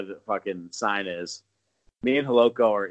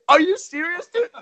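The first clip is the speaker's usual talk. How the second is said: high disbelieving squeal; 7 dB louder than usual. Retold or imitated. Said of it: "I was like".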